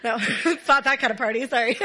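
laughing through the words, a bit flustered. Real, not acted. Laughing as she tells the room it's not that kind of party. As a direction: giggle it out, embarrassed.

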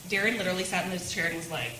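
usual speaking voice

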